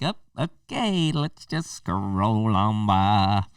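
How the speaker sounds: very slow, drawn-out bored voice; mock indifferent scroller. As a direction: drag it out like someone scrolling past. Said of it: "everybody's just like".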